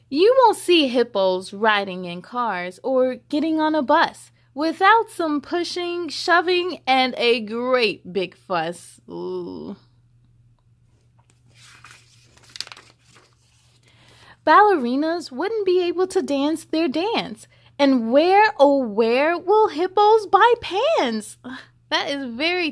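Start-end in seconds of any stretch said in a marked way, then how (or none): 0.1-18.5 put-on lively read-aloud to children; story reading. A narrator's reading. Reading the hippo book to children, lively storybook voice. 18.6-21.4 slow, playful storybook question; mock wonder. A narrator's reading. Asking the kids where hippos buy pants, slow playful wonder.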